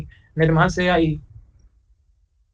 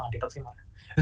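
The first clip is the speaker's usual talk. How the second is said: much softer, slow conceding "हाँ"; quiet admission; 7 semitones lower, 15 dB quieter, slower than usual. Acted. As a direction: admit it under your breath, slowly. Teacher concedes student's point.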